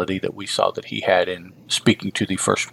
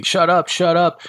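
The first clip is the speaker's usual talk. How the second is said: retold screaming; 8 semitones higher than usual. Overwhelmed collector runs away, mild mock scream. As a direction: imitate someone screaming, held back. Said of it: "he just runs away screaming".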